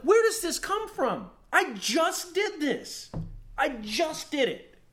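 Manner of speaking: high, loud, slow disbelieving cry; mock-outraged wail at himself; exasperated outburst. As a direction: throw your hands up in baffled rage. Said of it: "I'm like".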